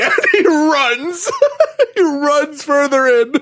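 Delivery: very high, loud squeal through laughter; real laughing excitement. Real, not acted. Fan retelling a favorite scene, high gleeful laughing cry. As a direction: crack up while telling it, voice shooting up.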